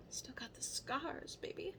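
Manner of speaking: cheeky whispered brag; whispered aside. Real, not acted. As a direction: whisper it like a secret. Cheeky aside about surgery scars.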